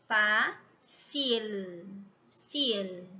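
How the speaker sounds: fácil and sil said slowly, the tongue held on the L; slow demo of the L tongue movement; exaggerated L. Shown as a demonstration. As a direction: overdo the final L in sil so the tongue movement shows. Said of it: "Tô fazendo assim pra vocês verem o movimento".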